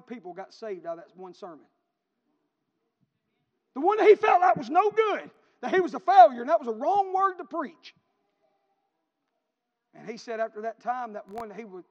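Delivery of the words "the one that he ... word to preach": loud, high preacher's cry; booming pulpit climax; preaching at full force. Real, not acted. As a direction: lift it over the congregation like a revival shout.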